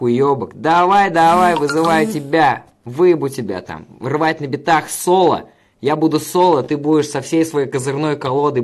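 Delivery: high, forceful call-out, easing at the end; loud challenge. Pretend. Streamer calls out a rival to battle, loud challenge. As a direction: throw down a challenge at full voice.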